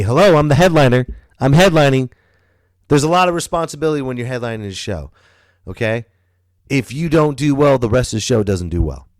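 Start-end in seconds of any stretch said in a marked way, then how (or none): 0.0-4.7 high, loud, puffed-up announcement; pompous headliner. Pretend. Comic bragging to the crowd about headlining, high and loud. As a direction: boast like a self-important star. Mock self-important headliner.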